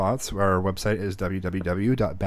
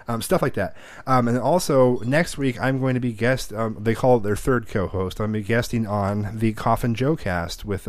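normal talking voice

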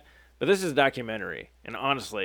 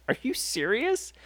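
high rising disbelief, imitating her; put-on incredulous voice, pitched way up; 10 semitones higher, 3 dB quieter than usual. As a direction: squeal the question up in mock astonishment. Acts the woman's incredulous reply.

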